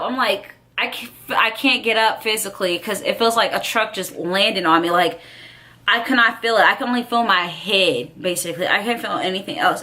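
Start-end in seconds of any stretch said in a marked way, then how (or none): none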